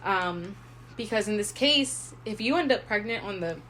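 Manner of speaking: usual speaking voice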